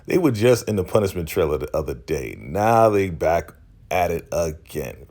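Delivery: ordinary talk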